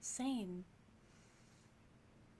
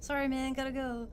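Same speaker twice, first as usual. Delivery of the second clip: louder, quick dismissal; loud, brushing off; 3 semitones higher, 7 dB louder than usual. Acted. Sinlia brushing off young Duncan on the way out, loud and quick. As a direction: blurt it and bolt. Player character leaving.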